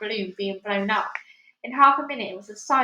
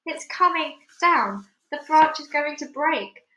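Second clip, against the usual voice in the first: alarmed; 5 semitones higher, 5 dB louder than usual. Acted. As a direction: cry it out in fear. Continued alarm.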